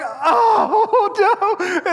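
high, loud dismayed wail; mock horror. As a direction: act out comic panic at being found out. Storyteller's horror at being found out.

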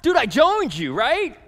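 high, loud, insistent pleading; pleading with John. Retold or imitated. Disciple pressuring John, loud and pleading. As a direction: corner John and beg him loudly. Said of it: "he's like".

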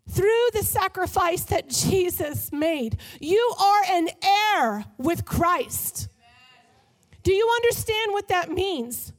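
slow, loud, high preaching; preaching at full force. Real, not acted. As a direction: build the message to a passionate peak. Preaching climax.

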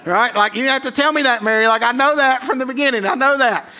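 high, loud laughing mock indignation; mocking Joseph. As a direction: play Joseph scoffing that he already knew, high and laughing. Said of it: "And he's like".

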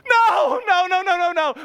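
high, loud frantic pleading; mock panic. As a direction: beg frantically. Kid panics at spoon threat.

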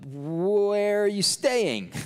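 high, drawn-out, hammed-up awkward question; awkward blurted question. Flustered disciples asking Jesus, awkwardly drawn out. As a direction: stretch 'where' out high, like a flustered follower.

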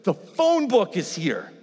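high, loud, slow thrill; excited announcement. Retold or imitated. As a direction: announce it like the best news ever. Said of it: "He's like".

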